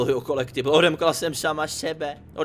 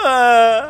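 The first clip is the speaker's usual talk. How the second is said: high, loud startled squeal; real startled cry; loud startled eee; 9 semitones higher, 10 dB louder than usual. Real, not acted. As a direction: yelp in surprise. Startled sound.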